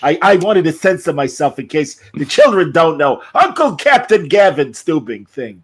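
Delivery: high comic drug dealer voice; Gilbert Gottfried. Acted. Big Chicken telling Gavin about smuggling, Gottfried impression. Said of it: "they mentioned on Gilbert Gottfried".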